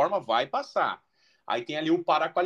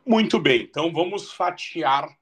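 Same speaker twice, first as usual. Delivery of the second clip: usual speaking voice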